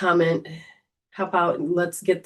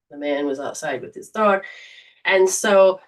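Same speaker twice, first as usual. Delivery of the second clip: ordinary talk, nothing marked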